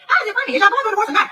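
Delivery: high, laughing disbelief; incredulous. Real, not acted. [name] mocking Drew's claim to the audience, incredulous and high. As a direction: scoff at the idea.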